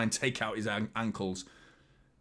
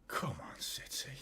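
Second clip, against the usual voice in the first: urgent whisper under his breath; hushed, hopeful whisper; whispered plea; 11 dB quieter, slower than usual. Real, not acted. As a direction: whisper it, willing the team on. Tense moment, urging his team.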